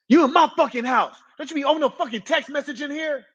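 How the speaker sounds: hammed-up tough-cop bark; imitating a yelling cop. Cop barking at an inmate over a text, mock yell.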